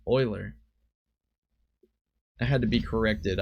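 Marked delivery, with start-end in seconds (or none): 0.1-0.5 Euler said OY-ler, falling; correct pronunciation. Shown as a demonstration. Card game commentator confirming how to say it, Euler as OY-ler. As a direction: say Euler to rhyme with boiler. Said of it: "That's how you say that".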